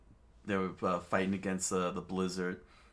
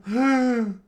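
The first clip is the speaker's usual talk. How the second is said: gasp; 14 dB louder than usual. A narrator's reading. Kid's relief, crowd surprise.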